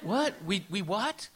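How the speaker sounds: put-on shocked child hearing slurs; stunned disbelief. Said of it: "I was like".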